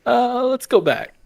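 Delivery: high, slow, nervous suggestion; a frightened hiker backing off; scared retreat. Retold or imitated. Lost friends hearing a howl, nervously high.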